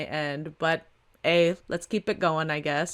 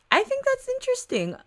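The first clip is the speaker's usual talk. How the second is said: mock high voice.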